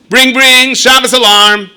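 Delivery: high loud ringing alarm; bright shouted bring bring; mock alarm ring. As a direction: ring it out like a clock.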